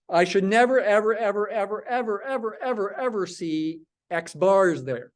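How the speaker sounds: "never" and every "ever" hit hard, a run of beats; stress on never ever. Shown as a demonstration. Teacher warning students about exam mistakes, stressing "never, ever". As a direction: pound each "ever" like a drumbeat. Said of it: "One more time for emphasis".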